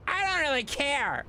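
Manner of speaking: a silly cartoon voice; high cartoonish I don't care; squeaky mock indifference. Mock nonchalance after laugh.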